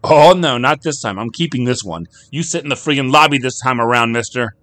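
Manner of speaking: high, loud no-nonsense order; a wife forbidding her husband the bar; stern scolding. Acted.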